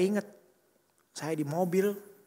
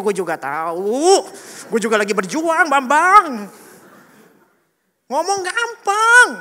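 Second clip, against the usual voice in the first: drawn-out, high comic yell; exasperated inner outburst; 9 semitones higher, 13 dB louder, slower than usual. Acted. Driver silently answers nagging relatives, exasperated yelling. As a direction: shout it out, stretching the endings. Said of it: "dalam hati gua".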